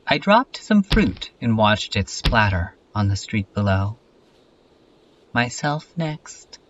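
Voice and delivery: deliberate, projected reading in short phrases; poetic recitation. Acted. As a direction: recite it line by line like a spoken poem. Recites an introspective piece, slowly.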